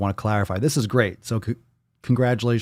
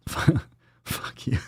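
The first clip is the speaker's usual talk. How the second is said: laughing curse; 7 semitones higher, 3 dB quieter than usual. Real, not acted. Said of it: "I love how my first reaction".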